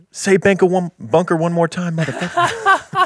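high, hammed-up threat that climbs into a squeal on the last word; mock tough-guy line. Cohost re-performing a fan's favorite line, hammed-up threat. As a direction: ham up the bunker line from the episode. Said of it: "Apparently they liked that line".

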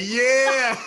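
a high, loud leering "yeah"; mock the professor checking her out. Host mimics professor ogling.

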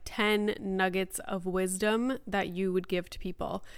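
ordinary talk, nothing marked

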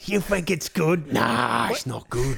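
high-pitched, hammed-up Cockney; Cockney accent. Mocking the co-host's impression, in high Cockney. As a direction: pitch it up into exaggerated mock Cockney. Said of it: "it's Cockney".

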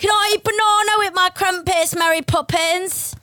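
high posh British, slowing at the end; a twee Mary Poppins-style English voice; mock British accent. Host repeating the joke in mock British. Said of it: "that accent really".

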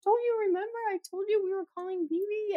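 put-on high, softish little-boy voice; child's protest. Son reminding mom, high childlike protest. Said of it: "And he's like".

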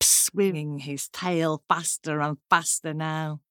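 ordinary delivery, nothing marked

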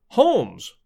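a startled loud call of the name; startled call on recognising him; cried out. A narrator's reading. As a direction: Gregson surprised at the doorway, crying out. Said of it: "he cried".

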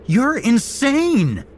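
high, loud, slow furious scream; enraged terrified scream. A narrator's reading. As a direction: scream it in his face. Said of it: "I screamed at him".

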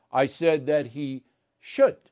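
should slowed and leaned on, rising; stress on should. Shown as a demonstration. Host correcting a listener, stressing should. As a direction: lean on should to set the record straight. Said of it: "not he would".

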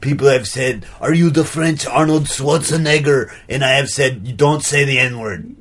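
put-on muddled French-Austrian artist accent; like a French person imitating an Austrian; affected French-Austrian accent, played straight; French Arnold accent. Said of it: "French person doing an Arnold Schwarzenegger impression".

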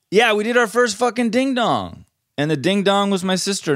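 high, bursting excitement; excited. Real, not acted. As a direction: blurt it out thrilled. Excited about the prank.

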